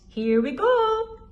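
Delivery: high, loud here we go; excited launch. A narrator's reading. Instructor calling takeoff, high and loud. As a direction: call it out as the plane moves. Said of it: "said the flying instructor".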